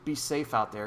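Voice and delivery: ordinary talk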